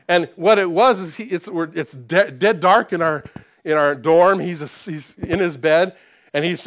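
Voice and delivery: ordinary talk, nothing marked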